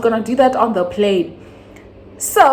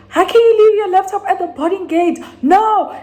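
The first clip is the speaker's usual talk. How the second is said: much higher, louder, fast outraged scolding; angry mom shouting; 13 semitones higher, 4 dB louder than usual. Retold or imitated. Mom scolding her daughter for leaving the laptop, angry shout. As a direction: yell at her like a furious mother. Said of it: "my mom is very angry".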